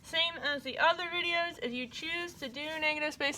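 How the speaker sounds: talking around a paintbrush in teeth; words slurred by a brush held in mouth. Real, not acted. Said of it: "I'm talking like that 'cause I had a paintbrush in my mouth".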